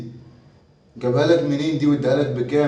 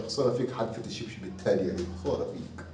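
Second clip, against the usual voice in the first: soft muttered contempt; scornful mutter; 7 semitones lower, 11 dB quieter than usual. Acted. Father grumbling at son, soft scornful mutter. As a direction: grumble it under your breath, disgusted.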